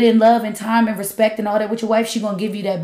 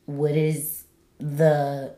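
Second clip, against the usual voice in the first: drawn out; 4 semitones lower, 4 dB quieter, much slower than usual. Real, not acted. Searching for a word.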